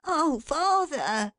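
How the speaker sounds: put-on high, slow child's whimper; whimpering boy. A narrator's reading. Son whimpering to his father in protest. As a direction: plead like a hurt little boy. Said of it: "whimpered Johnny".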